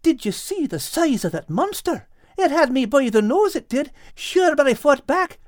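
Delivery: high, breathless bragging voice; excitedly. A narrator's reading. Al boasts about fighting the crab, excited. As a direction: tumble out the tale of the fight. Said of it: "said Al excitedly".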